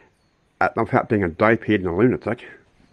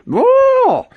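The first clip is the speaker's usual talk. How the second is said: a sudden loud high whoa; high shouted whoa; 8 dB louder than usual. Real, not acted. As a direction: yelp it out in shock. Sudden outburst mid rant.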